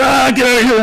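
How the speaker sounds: a hammed-up angry villager yelling. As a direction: drive the stranger away in anger. Villagers chasing off the preacher.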